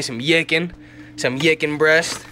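yicken said with a Y sound replacing the ch, slowly drawn out; yicken for chicken. Shown as a demonstration. Showing viewers his fridge, saying yicken for chicken. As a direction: swap the ch of chicken for a Y in yicken, yicken breast. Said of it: "Put the Y on the chicken".

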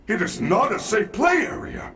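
loud scolding. Acted. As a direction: scold the kid loudly. Game robot scolding loudly.

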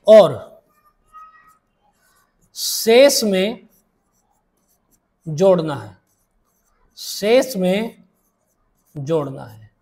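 drawn-out words with long pauses, dropping low at each close; slow dictation. Shown as a demonstration. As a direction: dictate it slowly for students writing it down. Teacher dictates rule for note-taking.

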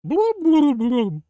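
very high, loud blubbing; silly animal noise. Shown as a demonstration. Streamer doing a goofy animal sound for chat. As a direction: burble like a cartoon fish.

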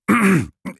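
a nervous, hesitant king clears his throat; throat clearing. Acted. King nervously clears his throat before making his wish, throat clearing. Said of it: "De koning schraapte zijn keel".

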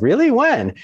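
high, loud "Really? When?" burst; surprised excitement. Retold or imitated. To his buddy buying a Malibu house, surprised and thrilled. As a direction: jump up in pitch with surprise.

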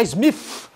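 "Smith" said as "smiff". Shown as a demonstration. English teacher showing a mistake, "Smith" as "smiff". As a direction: end "Smith" on a long F. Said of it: "não é F".

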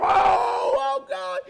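high, loud mimicked wail of grief; imitation of a distraught mourner; mock wailing. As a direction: cry out in hammed-up despair. Mimics worried, grieving people wailing.

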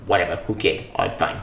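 low, quiet, grudging shrug of a voice; sulky dismissal. Acted. To viewers, dismissing the comic with a sulky shrug. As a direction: mutter it like you've given up.

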